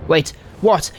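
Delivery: ordinary delivery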